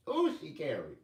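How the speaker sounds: normal talking voice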